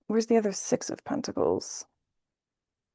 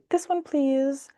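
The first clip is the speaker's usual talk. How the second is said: slow, delicate asking, higher and airy; gentle light request; 5 semitones higher, slower than usual. Retold or imitated. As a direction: pluck it gently, like choosing a treat. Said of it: "it's a lighter touch".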